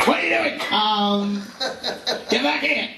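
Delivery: high, whiny pleading computer; needy computer voice. Pretend. His new computer calling him home, whiny plea. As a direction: beg like a lonely computer, slow at the end. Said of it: "that sounded terrible".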